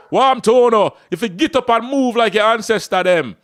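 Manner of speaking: put-on loud, rough country Jamaican accent. Coach voicing his rough Jamaican viewer, loud Jamaican accent. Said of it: "that Jamaican guy, he's crazy".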